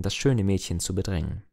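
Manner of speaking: ordinary delivery, nothing marked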